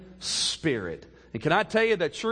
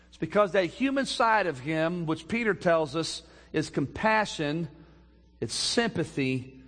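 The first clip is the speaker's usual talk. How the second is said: ordinary talk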